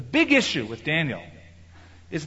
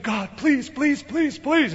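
high, pleading please said again and again; mock whiny begging; 10 semitones higher, much slower than usual. Believer begging God for something, in a mock high whiny plea. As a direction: whine it like a begging child.

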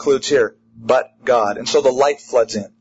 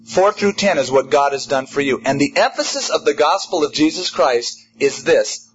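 ordinary delivery

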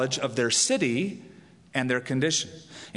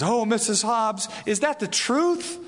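rising, eager question, much higher; hopeful perk-up; 6 semitones higher than usual. Acted. Mister K asks Missus Hobbs, perking up hopefully. Comic strip suitor perks up.

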